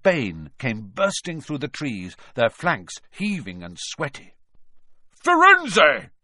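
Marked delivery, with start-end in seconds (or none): 5.2-6.1 furious roar of the name Firenze; thundering shout. Acted. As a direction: bellow Firenze high and very loud. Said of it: "Bane thundered".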